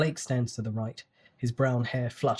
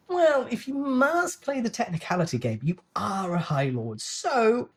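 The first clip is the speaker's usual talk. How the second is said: teasing prince; 10 semitones higher than usual. A narrator's reading. Prince teasing his advisor.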